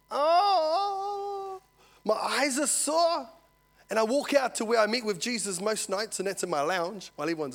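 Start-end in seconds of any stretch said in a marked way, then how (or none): 0.1-3.3 high, slow, drawn-out groan; hammed-up sick moan. Preacher recalling being crook, hammed-up moaning. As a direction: moan it as if aching all over.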